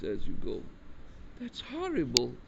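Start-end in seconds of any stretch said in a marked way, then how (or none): 1.4-2.3 put-on shocked girl. Girl reacts to the story.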